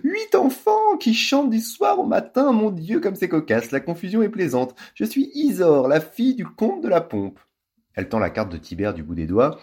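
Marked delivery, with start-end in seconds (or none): 0.0-7.4 very high, affected society lady; pretend countess. A narrator's reading. Ambre posing as a count's daughter, high affected gush. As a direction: gush like a pompous aristocrat. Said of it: "en faisant mine de rire".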